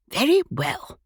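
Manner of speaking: ordinary delivery, nothing marked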